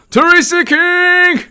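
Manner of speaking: very high, drawn-out name call; playful falsetto announcement of a name; high-pitched shout-out. Real, not acted. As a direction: squeal the name slowly. Calling out a viewer's name.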